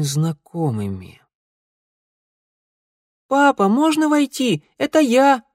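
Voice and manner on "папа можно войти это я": put-on high, eager child calling; little boy voice. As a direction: call out like a small boy. Child voice in memory.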